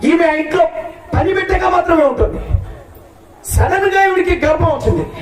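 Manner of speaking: preacher telling the congregation a Bible story; shouted storytelling. Real, not acted. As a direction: proclaim the scandal to the crowd. Preaching at full force.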